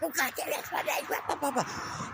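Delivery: high, mocking rant sliding into gibberish; mock cussing fit in a high voice; imitated cussing out. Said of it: "he starts cussing me out".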